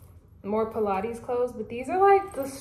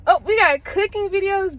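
loud, higher gush of delight; hyped about cooking videos; excited; 9 semitones higher, 8 dB louder than usual. Real, not acted. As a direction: sell it like the best feed ever. Excited over Explore feed.

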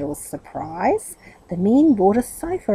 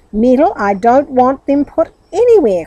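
louder exasperated protest; crying out; 4 semitones higher, 9 dB louder than usual. A narrator's reading. Aunt refusing the furniture, exasperated cry. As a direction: cry out in frustration. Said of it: "cried his aunt".

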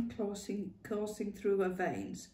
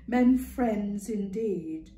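louder, amused scoff at "men friends"; mocking echo; 2 semitones higher, 8 dB louder, much slower than usual. Ma mocking Clara's coy phrase, amused. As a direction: throw "men friends" back with a knowing laugh.

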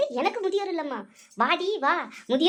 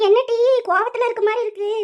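higher, louder neighbour asking; the visiting granny checks on her, pitched up; 5 semitones higher, 5 dB louder than usual. Acted. Neighbour asks the daughter-in-law why she's angry, higher and louder.

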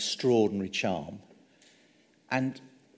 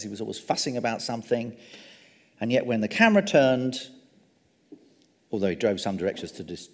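ordinary delivery

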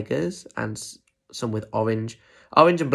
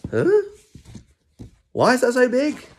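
high, baffled questioning; confused penguin; 13 semitones higher, 4 dB louder than usual. Acted. Penguin staring at the huge beetroot, confused. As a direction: squeak it out in utter puzzlement. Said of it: "he looks a bit confused".

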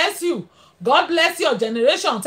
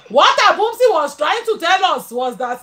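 ordinary delivery

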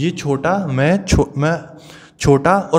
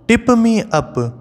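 slow, higher mock call of the teapot; 7 semitones higher, much slower than usual. Teacher voicing the teapot's shout for the class, higher and slow. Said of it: "उसने क्या चिल्लाना चालू करा".

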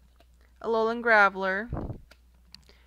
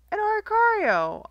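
much higher and louder, thrilled; excited squeal; 11 semitones higher, 7 dB louder than usual. Real, not acted. Pack opener squealing at a new pull. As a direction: squeal it with delight.

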